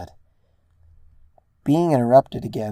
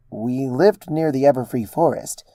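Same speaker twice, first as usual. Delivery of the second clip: ordinary delivery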